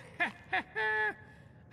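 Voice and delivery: high, gleeful little laugh; quick pleased chuckle; delighted laugh. Acted. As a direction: laugh with a collector's glee. Scholar laughs over the artifact.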